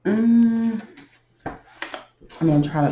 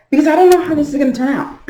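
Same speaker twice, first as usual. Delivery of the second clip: very high, fast and louder; high, rushed voice; 11 semitones higher, 7 dB louder, much faster than usual. Pretend. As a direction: rush it out in a raised, high-pitched voice. Breaks the slow talk, rushed high.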